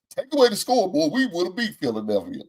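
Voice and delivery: high mock parody voice; high-pitched parody of Parsons; mocking impression. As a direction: ridicule him by parroting.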